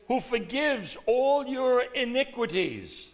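preached reading; scripture proclamation. Acted. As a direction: declare the verse. Quoting the psalm from the pulpit.

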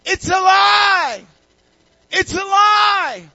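high, drawn-out full shout; excited yell. Acted. Chess master yelling to the group from another room, excited yell. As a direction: yell it out at the top of your voice. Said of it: "He's yelling".

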